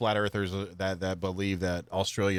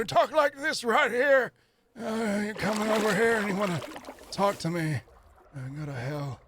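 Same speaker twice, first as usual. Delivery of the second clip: put-on old man voice; 13 semitones higher than usual. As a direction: ham up a cranky old geezer. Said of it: "The, the old man voice that I use".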